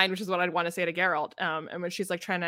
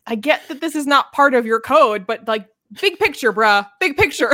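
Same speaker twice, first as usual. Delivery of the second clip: louder, higher, exasperated with Geralt; exasperated plea; 7 semitones higher, 9 dB louder than usual. Retold or imitated. Urging Geralt to see sense, exasperated and louder. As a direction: push it like you're fed up with him. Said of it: "you're like".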